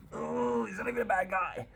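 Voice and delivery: very high, whiny mock lament; mock pitying wail. Reader feeling for the count, high mock wail. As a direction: coo it way up high in mock sympathy.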